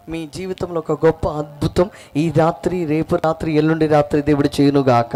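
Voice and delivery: loud, emphatic blessing over the crowd; preached blessing. Real, not acted. Preaches a blessing at full force.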